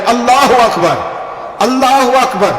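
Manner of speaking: high, ringing takbir; impassioned retold call; calling out praise. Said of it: "جناب اسماعیل بھی پکارے".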